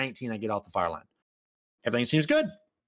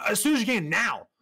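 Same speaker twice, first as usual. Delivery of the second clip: raised, insistent retort; impatient demand; 6 semitones higher than usual. Retold or imitated. Husband on the phone to the flower company, impatient demand. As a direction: press the florist for action right away. Retold angry call to florist.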